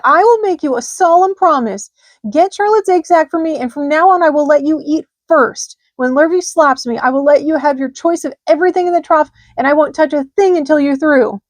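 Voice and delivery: high, loud earnest vow; high loud earnest pig; solemn promise. A narrator's reading. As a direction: promise it with all his heart. Wilbur makes solemn promise.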